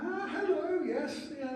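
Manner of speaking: high, loud, flustered hello; a sudden bright, embarrassed greeting; awkward fake recognition. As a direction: bluff that you know them, caught off guard. Faking recognition of a stranger.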